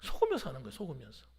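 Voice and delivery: ordinary talk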